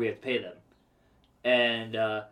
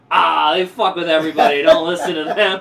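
louder, laughing mock of the guard; loud mocking repeat; 4 semitones higher, 13 dB louder than usual. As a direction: hammer the line back, laughing.

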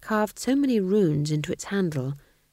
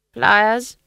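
louder, higher eager guess; ghost king guessing; 5 semitones higher, 8 dB louder than usual. A narrator's reading. Ghost king guessing at Nanny's game, loud and eager. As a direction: blurt out a hopeful guess. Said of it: "he suggested".

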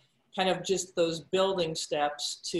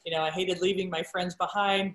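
usual speaking voice

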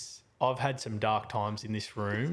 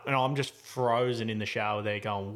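usual speaking voice

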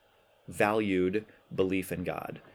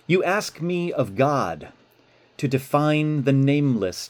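reading a love letter; 4 semitones higher, 6 dB louder than usual. Acted. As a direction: read it like a heartfelt letter. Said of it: "Here's the letter".

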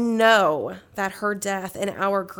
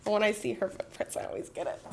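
getting emotional; 8 dB quieter, much faster than usual. Real, not acted. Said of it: "not get as emotional".

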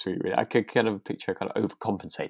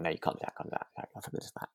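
fading to a whisper, repeating; muttering to herself; 3 semitones lower, 14 dB quieter, faster than usual. Retold or imitated. Alice stopping herself from drinking, fading to a whisper. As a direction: talk yourself down, softer each time. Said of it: "She's like".

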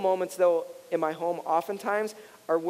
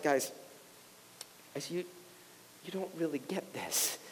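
hushed Jesus; 5 semitones lower, 14 dB quieter than usual. Retold or imitated. Jesus voiced gently to disciples.

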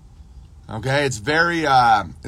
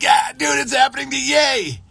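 much higher, loud shouted agreement; hammed-up shout, much higher and louder; excited shout; 11 semitones higher, 3 dB louder than usual. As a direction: yell it at the TV like a fan.

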